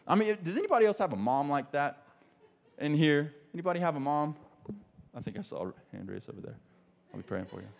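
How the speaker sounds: ordinary delivery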